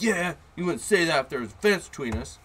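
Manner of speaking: mock kid's jeer; imitated taunting kid. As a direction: taunt like a cocky kid behind a fence.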